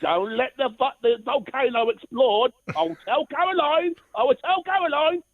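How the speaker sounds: high, mock-childish telling-on voice; teasing warning. Caller mimics himself warning Foxy, high and teasing. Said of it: "and I go".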